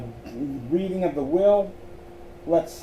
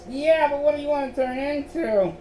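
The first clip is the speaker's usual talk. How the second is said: higher teasing quip; playful high wisecrack; joking; 12 semitones higher than usual. Acted. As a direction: toss it off as a joke. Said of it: "Ashley joked".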